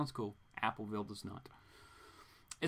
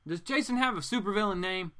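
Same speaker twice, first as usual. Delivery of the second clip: usual speaking voice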